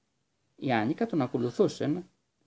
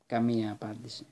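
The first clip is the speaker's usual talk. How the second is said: ordinary delivery, nothing marked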